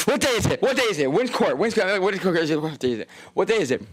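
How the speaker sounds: high, frantic repeated questions; panicked waking. Retold or imitated. Streamer reenacting waking up scared about court, high and frantic. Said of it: "And I wake up like".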